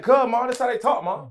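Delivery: put-on loud, high country-accented protest to mom; picked-up country accent. Son explains to mom how they talk, high country accent. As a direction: plead loudly in a mock country accent. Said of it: "I start sounding like them".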